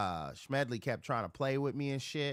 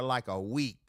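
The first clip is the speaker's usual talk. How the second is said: ordinary talk, nothing marked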